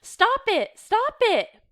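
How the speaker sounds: loud, high-pitched cry to stop; frantic protest. A narrator's reading. Reader voicing someone breaking up a squabble, frantic protest. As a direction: shout over the squabble. Acted quarrel over dividing.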